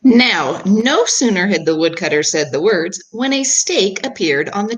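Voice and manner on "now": ordinary delivery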